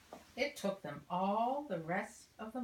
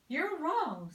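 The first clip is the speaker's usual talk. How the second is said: louder, higher insistent wife; sharp retort; 7 semitones higher, 7 dB louder than usual. Acted. Missus Bird correcting her husband, sharply. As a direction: snap back at him firmly.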